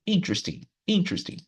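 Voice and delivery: "Interesting" with IN pitched high, then dropping; explosive first syllable. Shown as a demonstration. Teacher repeating for students, "Interesting" stressed on IN. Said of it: "ela vai explodir ali no começo".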